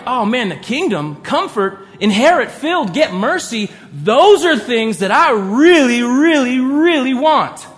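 excited, yearning read of the promises; eager reading. Shown as a demonstration. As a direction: read the rewards like you can't wait to have them. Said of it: "what if we read it the other way".

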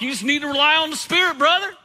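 hammed-up churchy cheerleader, lifting on brother. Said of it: "that's just how it would talk".

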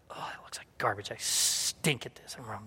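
low, hushed grumble to yourself; acted-out self-talk, very quiet and low; muttered self-criticism. Muttering self-criticism while painting.

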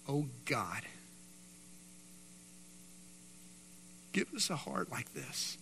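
soft, hushed prayer; quiet prayer. Acted. Pastor praying for a humble heart, softly and sincerely. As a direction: pray gently over the room.

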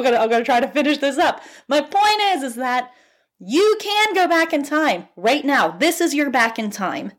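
rising excitement as the point lands; real fired-up pep talk; fired-up yelling. Real, not acted. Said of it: "I'm, like, yelling".

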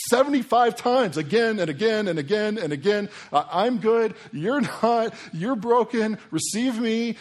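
high, loud, hammered-out declarations; insistent Jesus. Retold or imitated. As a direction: proclaim it forcefully, point by point. Voicing Jesus repeating his message.

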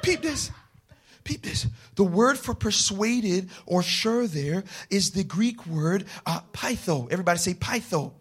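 soft, low explanatory voice; quiet teaching aside. Acted. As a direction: lean in and explain softly.

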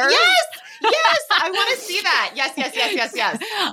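thrilled, high and loud; excited. Real, not acted. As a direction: burst out with joy. Excited about Twister sequel.

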